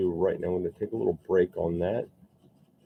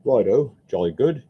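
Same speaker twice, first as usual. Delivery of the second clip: playful mock British accent, a little louder; 4 semitones higher, 6 dB louder than usual. Sculptor sculpting a British man, in a light British accent. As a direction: toss it off in a jaunty British accent. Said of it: "Seems appropriate that I do a British accent".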